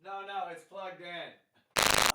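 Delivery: ordinary talk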